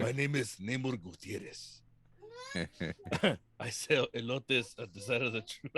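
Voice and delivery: slowed, hammed-up Mexican accent; mock Mexican accent. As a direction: introduce yourself in a joking Mexican accent. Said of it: "I had to say it with the accent".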